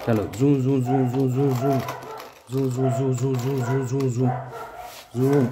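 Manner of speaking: playful zoom zoom engine sound; zooming engine noise. Pretend. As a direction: rev the toy bike with a sing-song zoom. Engine noise for toy bike. (खिलौना bike चलाते हुए zoom zoom की आवाज़)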